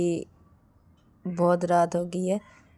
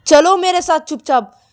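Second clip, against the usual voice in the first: high, loud command; angry order; 9 semitones higher, 9 dB louder than usual. A narrator's reading. Bride dragging reluctant partner into room, angry command. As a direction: order them along, no argument allowed.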